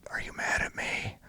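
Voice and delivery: retold whisper in an ambush; buddy whispering during an ambush. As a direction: whisper it so the enemy can't hear.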